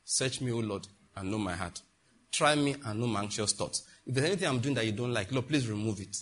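soft, low, humble prayer. Shown as a demonstration. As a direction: pray it quietly, humbly, to God. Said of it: "prayers that will not be popular".

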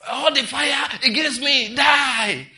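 slow, high, full-force shouted decree; mock prayer shout. Mocking believers who pray fire against enemies, shouting. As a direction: bellow it like an overblown deliverance prayer.

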